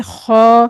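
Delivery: खौ thick and full from the throat; heavy kho. Shown as a demonstration. Teacher models the letter, thick throaty खौ. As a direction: say खौ heavy, with an o colour. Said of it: "इसको पढ़ना है खौ".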